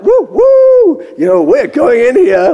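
high whooping, then excited chatter; mock hype. Preacher recalls setting out to pray, excited whooping. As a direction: whoop as if charging in. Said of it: "like this".

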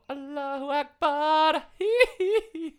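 high, slow chanted call; Jackson muezzin call. Retold or imitated. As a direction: chant it high then giggle.

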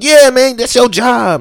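loud, high mock confrontation; officer imitating a heckler at an arrest; heckling bystander. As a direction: play an angry young guy yelling at the cops. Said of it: "He was like".

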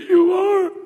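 put-on high, small child's answer; small boy. Son answering his dad's question in a high child voice. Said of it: "He goes".

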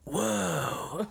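lightly voiced skater kids in unison; a hint of stoned surfer awe; awed skater kids. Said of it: "Like, in unison".

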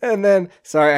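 high, loud burst through laughter; losing it with amusement; real laughing. Real, not acted. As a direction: apologise while cracking up. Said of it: "I'm laughing 'cause it's just so funny".

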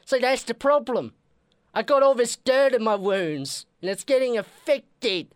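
put-on sick patient in a British accent. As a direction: croak it out in a bad British accent. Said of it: "something with British accents".

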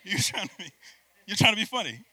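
hammed-up offended retort; mock angry snap.

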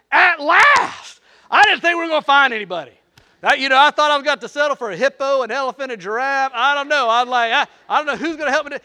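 loud, high, fast rush of relief; overjoyed Adam. Acted. Preacher voicing Adam meeting Eve, loud joyful gush. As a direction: gush like a lonely man finally saved. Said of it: "He's like".

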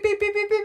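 high, loud mock alarm beeping; alarm beeps. Storyteller voicing the Shalcatraz cage alarm, high squealing beeps. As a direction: squeal the beeps like a siren going off.

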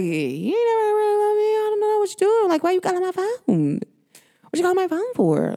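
high, whiny mock of a clingy partner. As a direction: mimic him in a high, mocking whine. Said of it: "that's what I was like".

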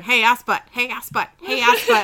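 loud repeated phone alert, mock robotic; phone voice imitation. Phone blowing up at work, loud mock ringtone. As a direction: blare it like a ringing phone. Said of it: "It was just going".

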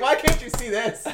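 ordinary talk, nothing marked

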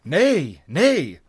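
high, loud protest; eager pirates protesting; eager cry. A narrator's reading. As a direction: cry out eagerly to reassure him. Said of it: "they cried eagerly".

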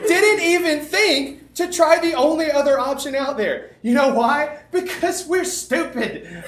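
high, loud, laughing sermon; fervent preaching. Acted. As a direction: preach it with full fire. Preaching to the audience.

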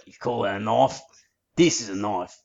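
broad Ocker Australian, "knife" as "noif"; Ocker accent. Shown as a demonstration. Showing the co-host the joke, broad Ocker accent. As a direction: lay the Ocker accent on thick. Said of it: "you need more of the Ocker accent".

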